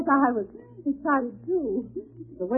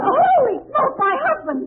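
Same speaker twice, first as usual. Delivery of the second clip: startled; 9 semitones higher than usual. Acted. Wife spots her husband.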